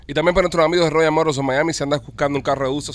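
upbeat radio pitch for a car dealer; live ad read. Acted. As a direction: sell it like a live on-air sponsor spot. Host reads car dealer ad. (lectura de anuncio en vivo, animada)

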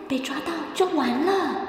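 ordinary delivery

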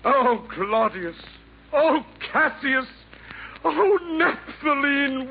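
high, slow theatrical wail; hammed-up lament. Assassin mourning his folly to Santa, high slow lament. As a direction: wring each name out like a tragic hero.

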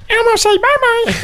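high squeaky puppet voice; Elmo voice. Acted. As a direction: play Elmo, tiny and high. Elmo voice done on air.

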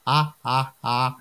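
put-on slow, high eerie kid singsong; creepy child voice. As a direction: sing it like a creepy little kid. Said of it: "in these creepy little kid voices".

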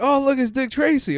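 put-on high, loud kid voice; teasing kids. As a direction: taunt it like classmates. Classmates mocking yellow costume.

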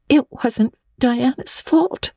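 put-on high, slow, sorry girl's voice; little Anne owning up, meek and high; contrite girl. A narrator's reading. Anne admits blame to Missus Lynde, high and contrite. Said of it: "said Anne contritely".

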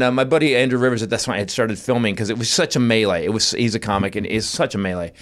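usual speaking voice

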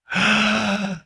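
sudden startled intake of breath; sharp gasp of realization. Acted. Friend gasping as he realizes his blessing. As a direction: gasp as it finally clicks.

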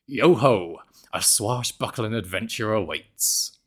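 over-the-top pirate storyteller, a touch of Somerset. Acted. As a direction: growl it out like an old sea dog telling a tale. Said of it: "I asked it to do it in a pirate's voice".

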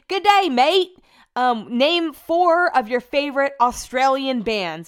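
loud, high fake Australian; bad Australian accent. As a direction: bellow slowly in a terrible Aussie accent. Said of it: "I do the worst impression of Australian".

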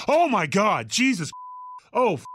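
mock shock. Friends seeing his bloodied back, shocked exclamations. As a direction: play a table of friends recoiling.

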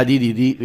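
دي دي دي with a voiced d; d sound. Shown as a demonstration. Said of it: "الناس هتسمعها". (نطق دي دي دي بحرف الدال)

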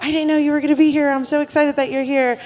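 high, fast, thrilled squeal; excited. Retold or imitated. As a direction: gush like a delighted fan. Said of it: "there's some folks that were like".

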